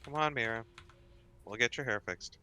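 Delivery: usual speaking voice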